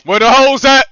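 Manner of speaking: ordinary talk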